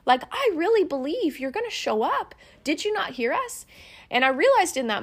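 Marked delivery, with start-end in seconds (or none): 0.0-2.3 higher, hurt insistence; retold prayer, higher and pleading; pleading to God. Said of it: "kind of broken for a moment". 2.6-3.6 high, aching plea to God; a broken prayer asked a second time; pleading to God. Retold or imitated. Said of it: "kind of broken for a moment".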